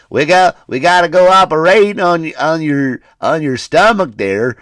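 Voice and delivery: hammed-up Southern surgeon, high and loud; mock a Southern doctor's accent. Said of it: "Not with that voice you won't".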